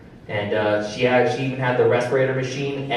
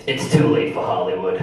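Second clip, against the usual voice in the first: put-on lower, deadpan old smoker's reply; gruff grandma line; 7 semitones lower than usual. Grandma answering her grandson after a puff, low deadpan. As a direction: drop the voice for the grandma.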